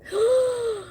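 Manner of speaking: sharp high gasp of outrage; shocked gasp. Real, not acted. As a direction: gasp in disbelief. Shocked at bus driver.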